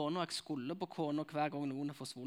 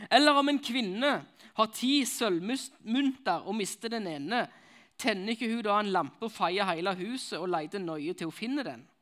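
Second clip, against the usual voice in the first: preaching the parable; 5 semitones higher, 9 dB louder than usual. Acted. Preacher reading the lost coin parable, full-force preaching. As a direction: proclaim the scripture to the whole hall.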